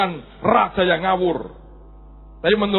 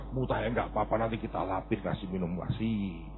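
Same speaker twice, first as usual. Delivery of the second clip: soft coaxing parent; 11 semitones lower, 13 dB quieter than usual. Retold or imitated. Ibu membujuk.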